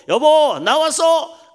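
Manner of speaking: loud, high, confident husband calling out; hearty, confident call. Acted. Husband announcing himself to his wife at home, hearty confident call. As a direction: call out boldly, sure of your welcome. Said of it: "씩씩하게 어디 가서 눈치 안 보고".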